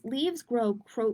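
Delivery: normal talking voice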